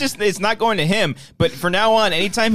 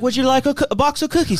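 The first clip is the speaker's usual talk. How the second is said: put-on high British little-girl cookie pitch; mock British accent; 8 semitones higher than usual. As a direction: imitate a British girl selling, high-voiced. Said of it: "she's putting on an accent".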